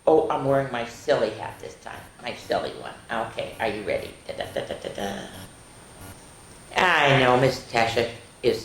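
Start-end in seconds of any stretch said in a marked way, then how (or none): none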